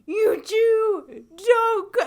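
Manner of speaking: high, loud pleading yell; mock yell at someone walking away; yelling after her. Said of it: "he's, like, yelling after her".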